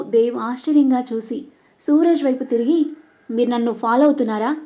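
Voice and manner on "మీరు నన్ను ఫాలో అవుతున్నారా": ordinary delivery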